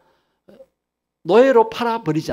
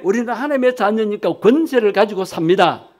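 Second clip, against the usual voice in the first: ordinary talk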